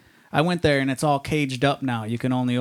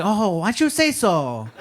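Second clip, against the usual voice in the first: put-on drawn-out, higher caricature Chinese accent; mock Chinese accent; 6 semitones higher, much slower than usual. Counter lady answers his order, mock Chinese accent.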